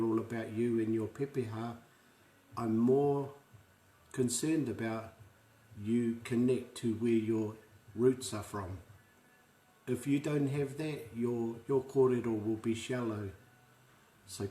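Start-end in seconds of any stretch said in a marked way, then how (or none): none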